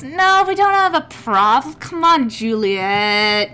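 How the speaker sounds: high, loud, jovial smoothing-over; placating half-elf. Acted. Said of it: "the half-elf kinda wraps his arm around the human woman".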